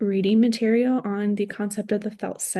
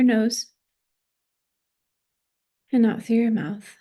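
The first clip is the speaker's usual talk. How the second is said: guided body scan. Acted. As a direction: guide them gently through the body, phrase by phrase. Leads a body scan meditation.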